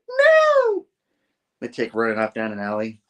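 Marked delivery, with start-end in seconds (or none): 0.1-0.8 very high, loud wail; panicked mock scream. Daughter as Tombstone leaps down, high loud scream. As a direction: cry out in hammed-up horror. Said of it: "And she's like".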